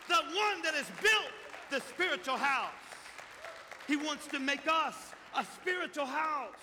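loud, high revival preaching; fiery pulpit proclamation; preaching at full force. Real, not acted. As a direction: cry it out to the church.

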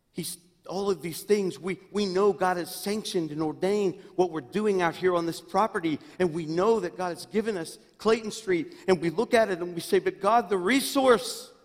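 high, loud, rising sermon cry; full-force preaching. Acted. Pastor preaching about God's provision, loud and high. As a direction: preach it out to the whole church.

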